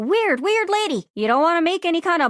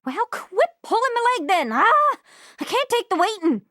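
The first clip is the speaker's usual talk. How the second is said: ordinary delivery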